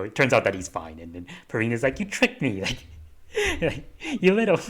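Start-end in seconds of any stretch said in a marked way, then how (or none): 2.0-4.6 high-pitched mock outrage breaking into laughter; playful mock scolding. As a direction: laugh through a teasing telling-off. Acting out playful scolding while laughing.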